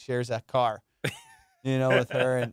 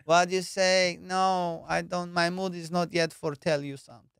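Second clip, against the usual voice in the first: put-on higher, broken-English foreign accent; a light foreign accent from a bride who doesn't understand; 4 semitones higher than usual. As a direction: play Siri as a confused mail-order bride. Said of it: "Siri is a ninety Day Fiance".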